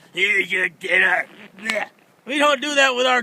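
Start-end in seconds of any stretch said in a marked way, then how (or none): 0.1-1.3 lower, slower, bored server plonking down slop; lazy lunch lady. Retold or imitated. Large Marge at the kitchen, dumping soup for a diner, in a lower, slow, bored voice. 1.6-1.9 disgusted blech. Retold or imitated. Disgust at sloppy served dinner.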